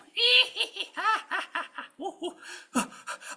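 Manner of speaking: long, high, loud cackle; evil witch cackle. Acted. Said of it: "surrounded by these evil witches".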